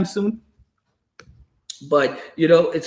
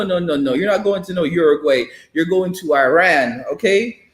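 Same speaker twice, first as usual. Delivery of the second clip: usual speaking voice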